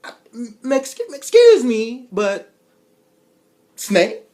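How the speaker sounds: very loud, high outrage; sputtering outrage. Retold or imitated. Loud sputtering outrage at being called a snake. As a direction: stammer then explode.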